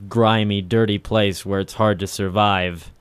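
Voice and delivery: ordinary delivery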